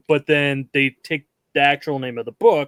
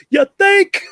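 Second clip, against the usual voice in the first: high, loud yelled comeback; yelled retort; 7 dB louder than usual. Retold or imitated. Host quoting the shark scene's reply, yelled back. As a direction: yell it back in a raised, high pitch. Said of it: "he just yells back".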